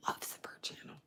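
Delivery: voiceless aside whisper. Real, not acted. Telling viewers about her cat, whispering. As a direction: drop to a secret whisper.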